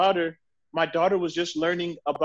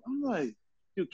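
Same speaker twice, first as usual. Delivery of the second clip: ordinary talk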